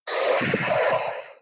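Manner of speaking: a long slow breath out, clearly heard; deep audible sigh. Shown as a demonstration. Parent modeling a calming breath: deep audible sigh. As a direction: let the breath out so a child hears it. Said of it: "So it might sound like this".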